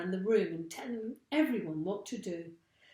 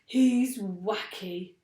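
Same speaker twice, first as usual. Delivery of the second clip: excited cry; 4 semitones higher, 5 dB louder, slower than usual. A narrator's reading. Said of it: "cried the roly-poly bird".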